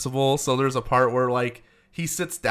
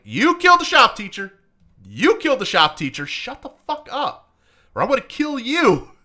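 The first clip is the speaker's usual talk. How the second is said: angry accusation; 8 semitones higher, 5 dB louder than usual. Retold or imitated. Art accusing in movie quote.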